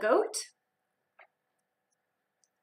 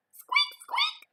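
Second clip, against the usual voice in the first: very high tiny squeaks; tiny high mouse squeaks. Acted. Mouse answers Duck.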